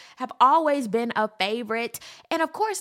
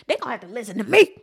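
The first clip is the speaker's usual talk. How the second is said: louder, fast insistent declaration; emphatic declaration; 9 dB louder, much faster than usual. Real, not acted. Insisting the senators listen to her, louder and faster. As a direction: drive it home, louder.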